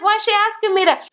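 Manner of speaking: high-pitched imitated indignation; going off. Daughter complaining to sister about mother's question, indignant. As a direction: act out an exasperated daughter. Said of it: "She went the fuck off".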